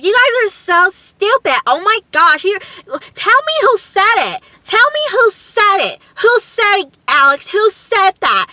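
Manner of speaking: very high, loud mock outrage; going off. Imitating Kara demanding Alex say who said it, going off. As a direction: demand answers in an imitated high-pitched rant. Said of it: "And Kara's just going off".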